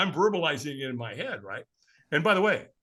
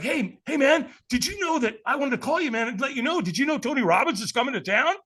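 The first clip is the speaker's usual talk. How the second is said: high, eager phone call; excited buddy on phone; 9 semitones higher than usual. Retold or imitated. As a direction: bubble over with the news. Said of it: "He's like".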